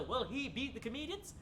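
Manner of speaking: normal talking voice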